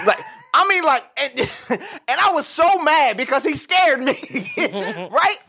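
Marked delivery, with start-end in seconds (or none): none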